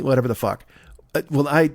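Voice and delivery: ordinary delivery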